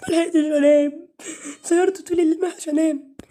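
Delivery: high, loud fake sobbing complaint; mock crying whine. Gamer mocking the character who slept badly, fake-crying whine. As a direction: whimper like a tired child, hammed-up. Mock whining about no sleep.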